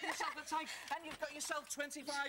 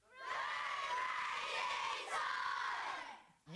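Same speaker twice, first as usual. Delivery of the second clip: long, high-flung showman yell; stretched-out game show cry; catchphrase shout; much slower than usual. Acted. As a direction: belt out the show's catchphrase.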